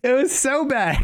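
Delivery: laughing it out at how bad it was; giggling at a cringe intro. Real, not acted. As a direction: say it through real laughter. Laughing at their own bad intro.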